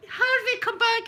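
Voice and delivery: high, loud call out across a field; calling the dog. Retold or imitated. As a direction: shout after the dog, laughing. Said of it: "and I'm shouting".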